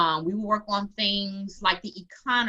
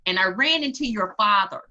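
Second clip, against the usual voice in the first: ordinary talk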